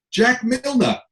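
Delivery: high, drawn-out billing announcement; hammed-up stage introduction; mock announcer. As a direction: proclaim it like a festival headline act. Reads festival headline like announcer.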